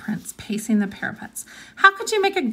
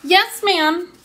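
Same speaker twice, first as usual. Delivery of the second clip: louder and higher chatter to be heard through the door; raised voice; 10 semitones higher, 3 dB louder than usual. Acted. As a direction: talk up loudly to warn him. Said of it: "Leslie raised her voice even louder".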